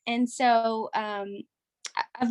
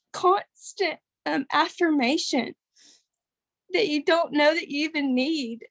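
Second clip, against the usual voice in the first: real tearful catch, voice pushed higher; fighting back tears, higher and halting; getting emotional; 5 semitones higher than usual. Real, not acted. Thanking the community on the call, real tearful and higher. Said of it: "I'm getting emotional again".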